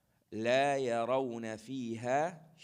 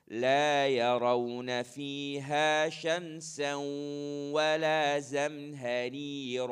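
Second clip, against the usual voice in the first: model recitation with ghunna; 2 semitones higher, 5 dB louder than usual. Shown as a demonstration. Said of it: "So this section".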